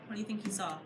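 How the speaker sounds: plain reading voice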